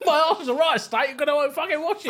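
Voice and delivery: high, hammed-up cockney geezer; mock cockney accent. Co-host joining in the workmate impression, mock cockney accent. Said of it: "He was like a cockney".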